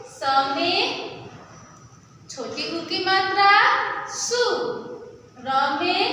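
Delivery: usual speaking voice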